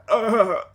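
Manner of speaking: loud high groan; a sudden pained uh. Acted. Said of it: "Bucky, you hear, uh".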